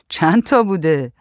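ordinary delivery, nothing marked

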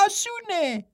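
high, light twin voice finishing his brother's line; a playful twin monk pitched well up. A narrator's reading. (صدای زیر و سبک راهب دوقلو)

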